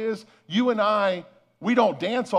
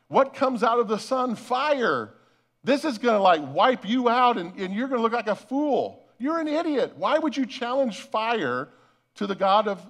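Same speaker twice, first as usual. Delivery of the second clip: higher-pitched mock disbelief at a fool; scornful crowd; 4 semitones higher than usual. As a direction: scoff at him like an incredulous onlooker. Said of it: "the people gathered around are thinking".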